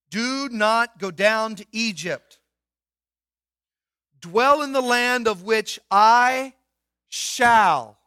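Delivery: slow, loud and weighty, each word set down; solemn scripture reading. Acted. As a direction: read it as the voice of God, grave and deliberate. Reading God's words from scripture.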